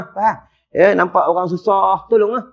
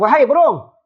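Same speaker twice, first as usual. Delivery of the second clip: pleading prophet; 8 semitones higher than usual. Retold or imitated. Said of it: "Kata Nabi Ibrahim".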